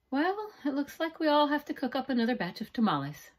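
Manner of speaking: cheerful. Acted. Aunt Rosa rallying the family to cook, cheerful. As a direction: lift everyone's spirits. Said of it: "Rosa said cheerfully".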